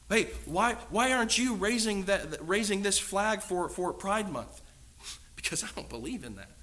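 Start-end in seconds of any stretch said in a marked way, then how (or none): 0.1-4.6 loud, higher, hammed-up challenge; mock demanding shout. A crowd confronting the believer, loud mock demand. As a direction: call him out across the crowd.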